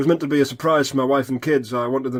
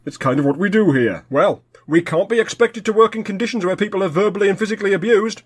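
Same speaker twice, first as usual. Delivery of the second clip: ordinary talk, nothing marked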